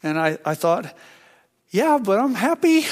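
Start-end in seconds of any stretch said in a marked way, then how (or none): 1.7-2.9 high, louder, acted-out delight; playfully overjoyed comeback; gleeful protest. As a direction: burst out happily, pitched way up. Acts out his joyful thought.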